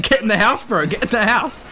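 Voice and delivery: urgent mock order.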